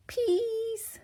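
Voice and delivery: put-on very high baby voice; high little piglet begging; toddler please. A narrator's reading. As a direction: lisp it like a tiny toddler. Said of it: "said Peppa".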